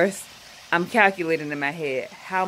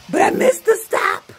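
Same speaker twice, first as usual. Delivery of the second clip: much higher and louder, frustrated exclamation; exasperated outcry; 7 dB louder than usual. Real, not acted. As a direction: blurt it out in dismay. Realizing she missed the stop.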